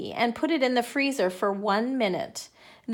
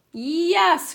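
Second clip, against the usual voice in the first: high, loud eager yes; excited cry; 7 semitones higher, 7 dB louder than usual. A narrator's reading. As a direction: shout it like eager children. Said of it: "cried the twins".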